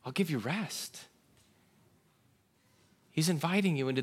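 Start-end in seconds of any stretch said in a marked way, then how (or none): 0.0-1.0 softly spoken, tender invitation; kind invitation. Acted. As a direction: invite them softly and kindly. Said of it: "so much more kindness".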